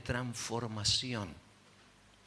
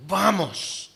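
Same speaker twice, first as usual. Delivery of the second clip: curt angry order; 6 semitones higher, 10 dB louder than usual. Retold or imitated. Marido furioso se la lleva.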